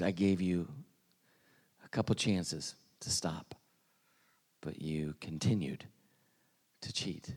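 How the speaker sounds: low, hushed, half-whispered scolding; hushed teacher rebuke. Retold or imitated. Teacher quietly telling a cheating student he's caught, hushed. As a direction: lean in and quietly bust the cheater.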